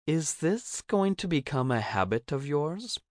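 high, lilting character voice with a rising question; Cricket asking playfully. A narrator's reading. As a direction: ask it up high, teasing. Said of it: "she asks".

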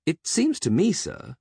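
high, carefully reasoning butler; barely hiding condescension. A narrator's reading. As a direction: reason politely, trying not to patronize. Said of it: "trying not to sound patronizing".

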